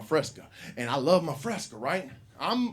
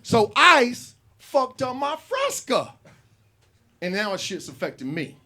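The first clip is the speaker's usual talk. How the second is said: ordinary talk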